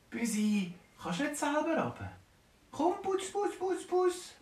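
high, sweet coaxing call; coaxing a kitten. Acted. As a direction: lure the kitten down gently.

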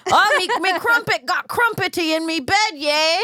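high, hammed-up British comic; mock British accent. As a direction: caricature a British joke-teller. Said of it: "Have you ever heard a British joke".

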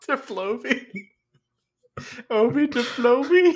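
high giggly words; giggling out the name; laughing. Real, not acted.

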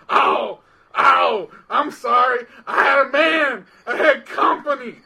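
high, loud hammed-up excuses; loud, high-pitched indignant rant; mimicked phone yelling. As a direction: imitate a client yelling down the phone. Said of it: "yelling at me on the phone".

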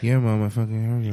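put-on low sulky kid's grumble; Iron Fist. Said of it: "low so she can't hear him".

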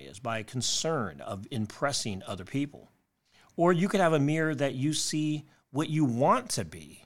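ordinary talk, nothing marked

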